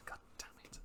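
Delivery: under-breath curse, fully whispered; whispered swearing. Real, not acted. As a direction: hiss it to yourself in frustration. Muttering frustration about own body.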